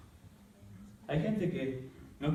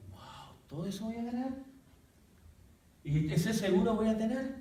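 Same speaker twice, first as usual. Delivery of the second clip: high, wide-eyed disbelief at the offer; amazed; 7 semitones higher than usual. Retold or imitated. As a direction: gasp it out like you can't believe your luck. His younger self amazed.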